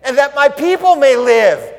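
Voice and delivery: high, loud pleading cry to the king; desperate plea. Acted. Queen pleading loudly before the king for her people. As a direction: beg for your people's lives at full voice. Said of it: "And then she says".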